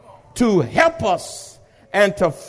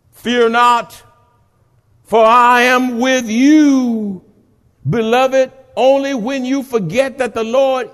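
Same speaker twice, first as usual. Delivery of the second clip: preaching at full force; 6 semitones higher, 7 dB louder than usual. Acted. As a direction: declare it boldly. Preached promise.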